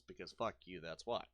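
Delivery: normal talking voice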